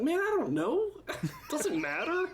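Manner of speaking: high-pitched, squeaky protest through laughter; mock exasperation. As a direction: play up the exasperation, voice leaping way up. Retelling his exasperated internet-fight reply.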